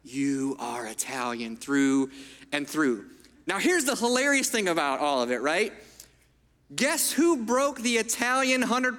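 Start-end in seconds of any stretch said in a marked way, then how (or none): 0.0-3.1 lower, slow, lightly voiced proud grandpa. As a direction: lean close and savor each word like an old grandfather. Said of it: "he'd lean even a little closer and he'd be like".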